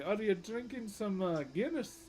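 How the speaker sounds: imitated Irish girl. Irish girl asks streamer about drink, imitated Irish accent. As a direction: put on an Irish woman's questioning lilt. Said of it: "she walks up to me".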